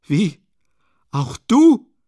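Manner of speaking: loud, high incredulous cry; outraged disbelief. A narrator's reading. Katerina catching sight of the landlady, outraged disbelief. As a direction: let it leap up into a shouted question. Acted quarrel, turning on landlady.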